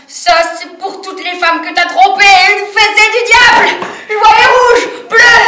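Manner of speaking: high, loud vengeful shout; building to full-voiced fury; raging. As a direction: shout it while landing the blows. Raging while beating her rival.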